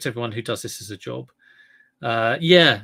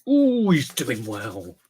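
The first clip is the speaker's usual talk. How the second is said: impressed ooh; 5 dB louder, much slower than usual. Real, not acted. Impressed at viewer's success.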